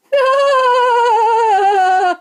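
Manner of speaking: high, loud giggling cackle; peals of laughter. A narrator's reading. Little men laughing at the visitors, high peals of laughter. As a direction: burst out laughing high and long. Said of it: "burst into peals of laughter".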